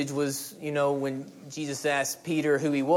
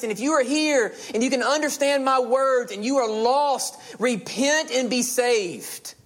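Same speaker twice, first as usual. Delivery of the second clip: high, driving sermon delivery; fervent pulpit appeal in punchy phrases; impassioned preaching; 7 semitones higher than usual. Real, not acted. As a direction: preach it with urgency, pitch held high. Preaches at full force to congregation.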